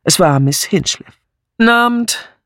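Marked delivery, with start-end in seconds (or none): 1.6-2.3 brusque greeting, pitched higher. A narrator's reading. Miss Hinchcliffe arriving at the door, brusque. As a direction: throw out the greeting gruffly. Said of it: "sagte sie barsch".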